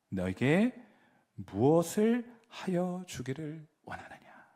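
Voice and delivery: slow gentle whisper; whispered question. Retold or imitated.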